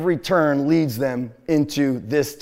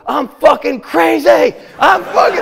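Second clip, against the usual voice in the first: crazed screaming; 12 semitones higher, 9 dB louder than usual. Retold or imitated. Driver announcing his madness to the guys, crazed screaming. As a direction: announce it at full scream, acting unhinged. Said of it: "I start announcing that I'm crazy".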